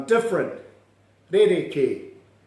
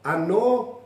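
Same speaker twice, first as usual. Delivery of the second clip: "Anō" climbing higher to the end; "Anō" as a higher, questioning call; voice going up; 4 semitones higher than usual. Shown as a demonstration. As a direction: send the last vowel of "Anō" upward. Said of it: "Hear the voice go up".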